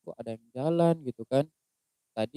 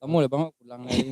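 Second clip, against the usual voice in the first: ordinary delivery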